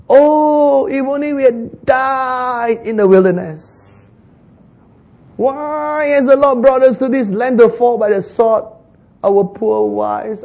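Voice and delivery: high, slow wailing lament; whining complainers. Acted. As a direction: wail it like the grumbling crowd. Voicing the Israelites' complaint.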